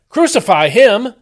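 high, loud, slow shout. Acted. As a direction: bellow it out as an angry mob. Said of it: "And they shouted back".

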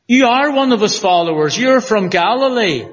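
hint of a sharp maid; accusing servant maid. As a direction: corner him with it.

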